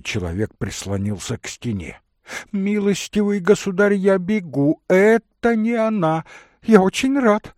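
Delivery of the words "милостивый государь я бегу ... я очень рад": high, loud, excited burst; overjoyed relief. Acted. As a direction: exclaim with giddy relief. Man overjoyed it is not her. (радостно и возбуждённо, высоко и громко)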